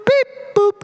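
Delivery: high electronic beeps, counting in like a metronome voice; click track lady impression. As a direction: mimic the robotic lady in the musicians' earpieces, beeping high. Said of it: "a nice lady who sounds like this".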